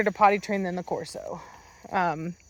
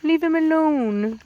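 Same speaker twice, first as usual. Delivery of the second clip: higher, slower put-on peacemaker dog voice; voicing the dog; 6 semitones higher, slower than usual. Owner voicing Spooky breaking up the puppies, higher put-on voice. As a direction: speak for the gentle dog. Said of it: "He's like".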